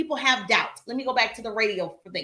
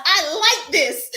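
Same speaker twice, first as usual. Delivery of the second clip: louder, higher retold delight; gleeful realization; 5 semitones higher, 5 dB louder, slower than usual. Speaker recalling discovering the drive-thru mic, gleeful realization. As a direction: hit it with delighted emphasis.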